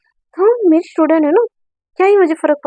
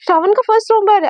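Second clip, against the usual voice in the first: insistent mother; 6 semitones higher, faster than usual. Acted. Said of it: "तो उसकी माँ बोलती".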